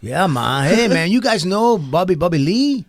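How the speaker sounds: hammed-up street accent; fake Spanish accent. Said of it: "I had to fake a Spanish accent".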